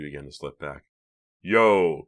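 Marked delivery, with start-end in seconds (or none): 1.4-2.0 mild narrated yell; understated scream. A narrator's reading. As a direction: holler it out briefly. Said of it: "Clay screamed at the top of his lungs".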